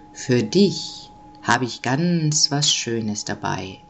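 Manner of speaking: low, slow big-man voice; jovial Nikolaus. A narrator's reading. Nikolaus promises the child a present, low and jovial. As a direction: promise a treat, deep and unhurried. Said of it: "lachte der Nikolaus".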